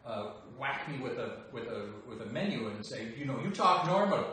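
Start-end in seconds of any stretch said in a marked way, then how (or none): 3.4-4.3 loud, high mock outburst; retold barmaid's scolding, pitched high and loud; imitating freaked-out barmaid. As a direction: imitate an exasperated barmaid telling off a customer. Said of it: "like this, and she, was freaking out".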